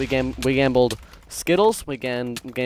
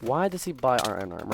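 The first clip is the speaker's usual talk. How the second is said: ordinary talk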